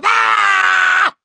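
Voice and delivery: sudden loud high yell of fright; fear scream. Retold or imitated. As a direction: let out a short startled scream. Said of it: "with me and I go".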